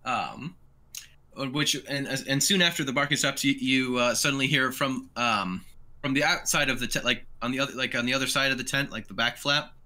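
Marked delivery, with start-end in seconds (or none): none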